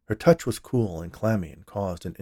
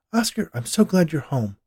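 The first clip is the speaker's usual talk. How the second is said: higher, louder wifely voice; gushing wife; 8 semitones higher, 5 dB louder than usual. A narrator's reading. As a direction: greet your husband eagerly for company's sake. Wife greets husband before guest.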